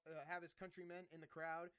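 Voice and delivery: ordinary talk, nothing marked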